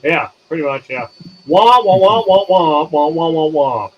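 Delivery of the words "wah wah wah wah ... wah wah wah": high, loud wah-wah fading down; mock muffled wah-wah voice, fading; muffled trumpet wah-wah. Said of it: "you sound like this".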